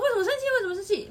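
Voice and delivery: 模仿慌张的女生，声音明显拔高; 急急地追问，像心里发慌; 慌张追问. Retold or imitated. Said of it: "B女很慌张啊". (学朋友慌张地一直追问)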